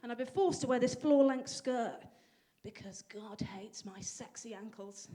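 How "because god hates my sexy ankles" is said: hushed, slow, unvoiced aside; whispered aside. Joking to the audience about the skirt, whispered. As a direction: whisper it like a confession.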